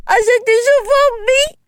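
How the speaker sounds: high, tearful plea; child's crying voice. Acted. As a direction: beg through tears for mother. Crying child begs for help.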